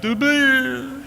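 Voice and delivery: high, drawn-out tearful greeting; hammed-up sobbing through a speaker's opening; mock blubbering. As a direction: wail the greeting, voice shooting up. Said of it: "I'm blubbering and weeping".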